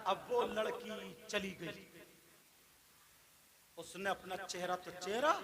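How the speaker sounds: soft, low voice with long pauses; quiet, grave preacher's narration; hushed sermon storytelling. A narrator's reading. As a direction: drop right down for the tale. Tells a tale in lowered voice.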